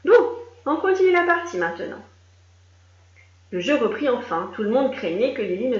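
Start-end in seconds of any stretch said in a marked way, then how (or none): 0.1-2.0 brisk referee. Acted. Referee wrapping up.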